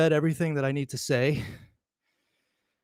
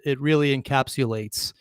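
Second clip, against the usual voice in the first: normal talking voice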